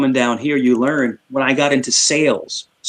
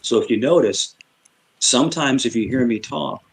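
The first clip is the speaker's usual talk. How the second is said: usual speaking voice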